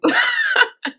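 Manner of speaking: very high-pitched giggle; squealing burst of laughter; real laugh. Real, not acted. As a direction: crack up at your own joke. Laughs at own retelling.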